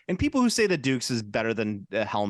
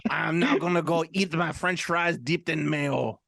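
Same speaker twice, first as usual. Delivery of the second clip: slow, higher put-on voice of an offended Belgian; mock Belgian listener; 4 semitones higher, slower than usual. Host teasing the one Belgian listener, mock-offended impersonation. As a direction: imitate an affronted Belgian, drawn out. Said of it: "our one Belgian listener will be like".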